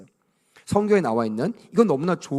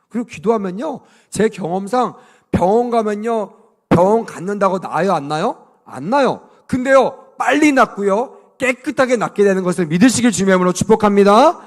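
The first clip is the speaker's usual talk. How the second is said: ordinary talk, nothing marked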